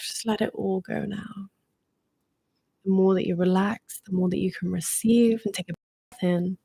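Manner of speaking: calm guide easing listeners into relaxation; guided meditation. Acted.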